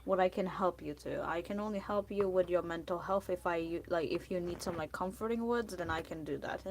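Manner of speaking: usual speaking voice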